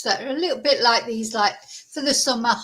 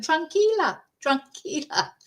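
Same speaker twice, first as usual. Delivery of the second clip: soothing saleswoman; 7 semitones higher than usual. Retold or imitated. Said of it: "she's going".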